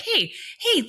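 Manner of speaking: high, playful hey hey; mock-chide with a laugh; teasing. Teasing the driver to let go of the wheel, playful and high. Mock telling him to relax.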